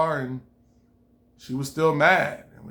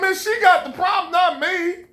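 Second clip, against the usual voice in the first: high, loud, indignant shout; shouted retort; 13 semitones higher, 4 dB louder than usual. Retold or imitated. At the show, him shouting back that she's the problem. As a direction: yell it out like he's had enough.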